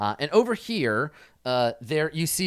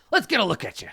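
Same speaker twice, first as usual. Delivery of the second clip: high, brisk examining doctor; raised, hearty character voice; old doctor; 9 semitones higher, 4 dB louder, faster than usual. Acted. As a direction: size up the patient with sudden vigour. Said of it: "He's like".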